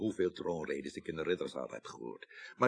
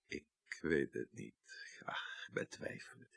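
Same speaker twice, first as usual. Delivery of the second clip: whispered doubt; 5 semitones lower, 12 dB quieter, slower than usual. Acted. Doubtful muttered reply.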